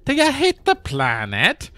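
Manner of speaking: high, hammed-up Irish authoritarian; mock Irish accent. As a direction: put on an Irish enforcer's scolding. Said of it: "authoritarian person with an Irish accent".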